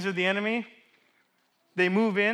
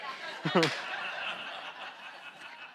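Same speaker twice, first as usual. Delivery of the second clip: breathy laugh; 8 dB quieter than usual. Real, not acted. Preacher laughs at his story.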